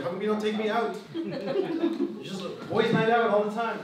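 mildly put-on wife's protest, lifted at the end; wondering wife. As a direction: imitate a wife asking why she's left out. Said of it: "the wife is wondering, like".

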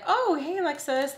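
high, sing-song fake friendliness; mock a phony cheerful hello; fake sweet greeting. Mocking a disliked girl's fake hello.

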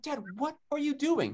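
high-pitched, embarrassed protest; acting out an embarrassed son, voice way up; mortified. Mortified son confronts dad at the consulate, embarrassed. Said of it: "mortified at my dad, so embarrassing".

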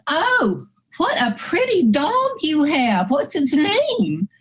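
high-pitched, cooing admiration; woman on the beach. Retold or imitated. Woman stopping a dog owner on the beach, high gushing voice. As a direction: gush over a dog, drawing out the question. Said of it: "the woman stops us and she says".

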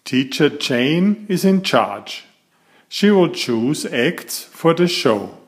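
plain reading voice

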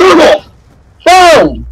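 high, loud bursts; excited shout. Real, not acted. Player calling out shots mid-game, excited shout. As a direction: yell each word like a shot.

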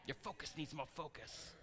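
very soft, badly faked Chinese accent; hushed mock Chinese-accented mentor; terrible Chinese impersonation. Movie mentor advising the boy, hushed fake Chinese accent. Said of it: "Is that a hor-, terrible Chinese impersonation?".

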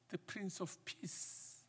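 ordinary talk

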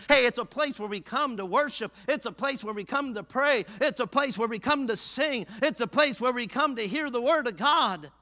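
high, driving sermon cadence; full-force preaching. Real, not acted. Preacher telling congregation why they gather, full-force preaching. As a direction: hammer each phrase in a rising preacher's refrain.

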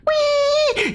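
very high "uii" noise; imitated squeal. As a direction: mimic a tiny squealing noise. Imitates small noise in game. (chillidito agudo imitado)

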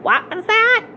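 high-pitched, questioning librarian; querying librarian. A narrator's reading. Librarian leaning over the counter to a girl, querying sharply high. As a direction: pipe it up high, as if hard of hearing.

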